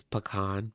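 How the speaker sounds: "pecan" said puh-KAHN, open ah at the end; puh-KAHN pronunciation. Shown as a demonstration. Telling viewers it varies, "pecan" as puh-KAHN. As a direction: say "pecan" as puh-KAHN, weight on the second syllable. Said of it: "It's not always pecan".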